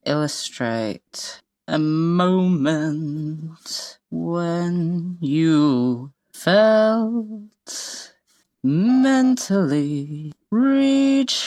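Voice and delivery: slow drawn out. Shown as a demonstration. Saying the title while writing it.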